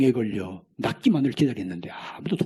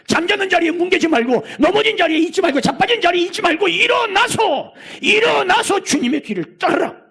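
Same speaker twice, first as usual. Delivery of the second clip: high, loud crying shout, slowing at the end; preaching at full force; 8 dB louder than usual. Real, not acted. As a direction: rouse them with a sobbing cry to rise. (높고 크게 울부짖는 설교, 끝은 느리게)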